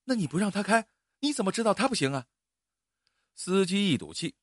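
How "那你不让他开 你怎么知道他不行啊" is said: high, fast sharp comeback; heated retort. Acted. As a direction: snap back at the driver. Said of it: "竟开口顶撞司机". (刘医生急了，又高又快地顶撞司机)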